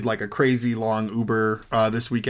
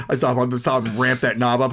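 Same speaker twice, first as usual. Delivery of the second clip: ordinary talk, nothing marked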